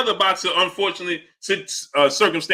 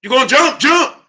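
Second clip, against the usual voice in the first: movie principal quote; 8 semitones higher, 5 dB louder than usual. Retold or imitated. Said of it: "How you say it on, on, on, uh, uh, um, Lean On Me?".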